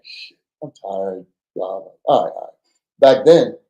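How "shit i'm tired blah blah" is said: mumbled, weary and soft; tired sigh. Retold or imitated. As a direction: grumble it like you're worn out. Weary mock complaint quoted.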